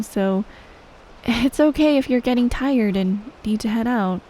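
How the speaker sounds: ordinary delivery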